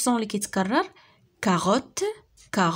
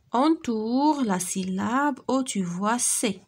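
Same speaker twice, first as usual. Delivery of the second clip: slow, clear reading aloud; slow instruction read; much slower than usual. Shown as a demonstration. Teacher reading the exercise to pupils, slowly. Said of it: "teacher reads instruction slowly".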